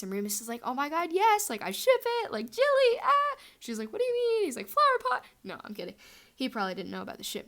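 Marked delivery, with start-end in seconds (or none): 0.6-3.4 much higher and louder, shrieking with glee; hammed-up fan squeal over a new couple; fangirl squeal. 3.9-4.4 much higher and louder; puzzled squeak; mock-confused squeal; baffled. Lily confused by the ship name. 4.7-5.2 much higher and louder; hammed-up squeal of a silly joke. Joke shipname reply, hammed.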